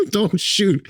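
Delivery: very high, frightened squeak; hammed-up begging for your life; mock panicked plea. Tiler pleading with a man pointing a gun, mock panic. Held at gunpoint, retold.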